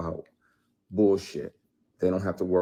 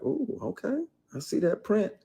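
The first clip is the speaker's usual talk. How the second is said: high teasing voice, much higher; mocking the actress; 13 semitones higher than usual. Mocking the actress who grabbed him, high and teasing. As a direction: lilt up high like the flirty actress.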